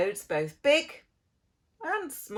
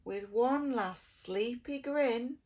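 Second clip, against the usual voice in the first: ordinary delivery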